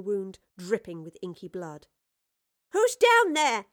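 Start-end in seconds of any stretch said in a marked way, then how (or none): 2.7-3.7 loud, high woman's holler; hollering downstairs. A narrator's reading. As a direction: call down the stairs, loud and high. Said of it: "voice hollered from the top of the stairs".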